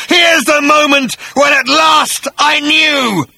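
high, slow hateful shrieking shout; feverish shriek. A narrator's reading. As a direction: scream it at fever pitch of hatred. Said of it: "now reaching a feverish pitch of hatred".

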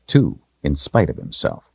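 usual speaking voice

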